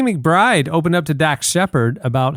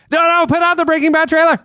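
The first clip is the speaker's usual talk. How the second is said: much higher, hurried order; high urgent panic; panicked executive; faster than usual. Retold or imitated. Netflix exec ordering the trailer out, high. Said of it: "it's like".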